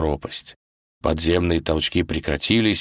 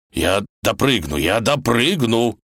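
ordinary delivery

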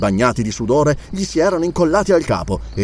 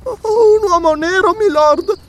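put-on slow, very high frightened child; stammering; 4 dB louder, slower than usual. A narrator's reading. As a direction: falter it out as a scared little girl. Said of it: "balbettò".

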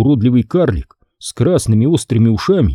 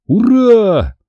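a high, louder cry of joy; cheering shout; 11 semitones higher, 3 dB louder than usual. A narrator's reading. Conor cheers his lucky dice roll, high joyful shout. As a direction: whoop it up. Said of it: "закричал я".

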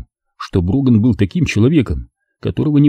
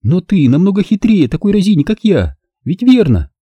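lightly voiced seductive woman, pitched higher; higher woman's voice, lightly played; Zelda's voice; 10 semitones higher than usual. Zelda coaxing Rick.

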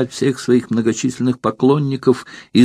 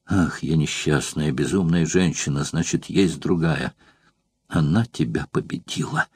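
soft, lower, despairing lament; near tears; 6 semitones lower, 7 dB quieter than usual. Acted. Rejected courtesan lamenting to Metrobius, quiet and despairing. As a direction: mourn her loss quietly, almost sobbing. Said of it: "куртизанка зарыдала".

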